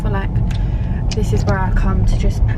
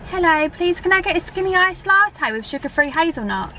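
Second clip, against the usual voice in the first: polite order voice; 8 semitones higher than usual. Real, not acted. Ordering at Starbucks counter.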